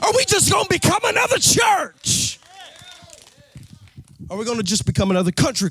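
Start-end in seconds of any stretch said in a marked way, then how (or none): 0.0-2.4 high, shouted crescendo; preacher's rising shout; full-force preaching. Acted. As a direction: build to a cry. Preaching climb.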